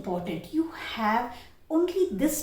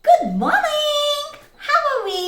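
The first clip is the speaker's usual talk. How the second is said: much higher and louder, very slow; roly-poly greeting; 10 dB louder, slower than usual. Shown as a demonstration. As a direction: sing it out with a playful action. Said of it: "things like that".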